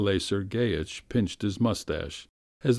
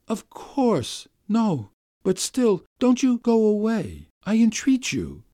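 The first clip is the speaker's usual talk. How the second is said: high, louder entreaty; entreating; 13 semitones higher, 4 dB louder than usual. Acted. Master entreating the governess to stay, high and urgent. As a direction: plead with her not to go.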